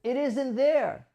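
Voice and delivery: mock flat denial of what mom sees; the wrong way, contradicting mom; dismissive. Shown as a demonstration.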